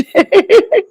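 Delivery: bright high-pitched giggle; a quick squealing laugh; a giggle that jumps way up. Real, not acted. Giggle at family joke.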